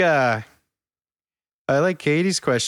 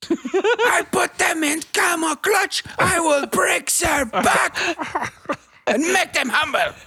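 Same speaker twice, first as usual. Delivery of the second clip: put-on slow shouted wrestler accent, high; Iron Sheik; slower than usual. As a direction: bellow it like a hammy wrestling heel. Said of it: "Just wanted to do an Iron Sheik".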